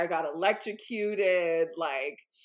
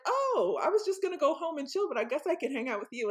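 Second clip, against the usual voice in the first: Flavio chilling; 8 semitones higher, faster than usual. Retold or imitated. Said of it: "He was like".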